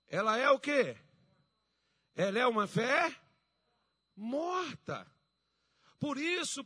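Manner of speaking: high, loud, emphatic proclamation with drawn-out words; preaching. Acted. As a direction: quiz the congregation forcefully, leaving ringing pauses. Preaching at full force.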